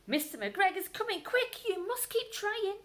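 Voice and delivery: high urgent little bird; sparrow voice. Acted. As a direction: chirp it, pressing. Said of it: "urged some friendly sparrows".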